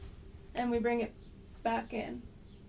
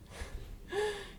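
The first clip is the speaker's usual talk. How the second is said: laugh; 9 semitones higher, 5 dB quieter than usual. Real, not acted. Laughs at the dog.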